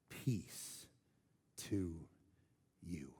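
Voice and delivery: whispered, very soft and slow; Jesus greeting the disciples in a whisper; hushed whisper. Acted. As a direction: breathe the words out gently. Voicing Jesus's first words.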